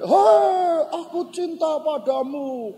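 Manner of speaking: loud high "hei" then settling; big high echoing shout, easing off; echo answering back. Retold or imitated. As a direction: imitate a ravine shouting the call back. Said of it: "Lalu dari sana balik".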